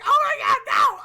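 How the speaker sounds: half-playful shout of horror; inner scream. Retold or imitated. Reliving her silent panic, a mock scream. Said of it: "I'm, like, screaming".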